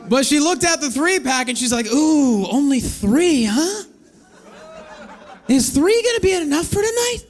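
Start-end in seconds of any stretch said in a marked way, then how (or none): 1.9-7.2 teasing woman. Retold or imitated. Woman teasing about the three-pack.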